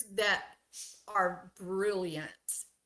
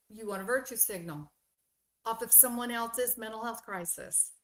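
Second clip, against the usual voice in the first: normal talking voice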